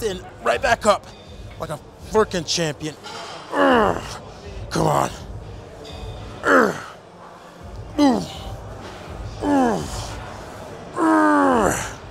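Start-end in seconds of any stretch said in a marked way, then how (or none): 3.5-12.0 straining grunts. Real, not acted. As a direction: grunt hard through the rep. Grinding through heavy reps.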